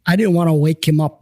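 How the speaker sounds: usual speaking voice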